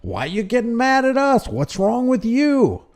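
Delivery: high put-on voice, slowing at the end; mocking cop impression. Host mocking officers who approached a man, mocking impression. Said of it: "The guy who approached a man".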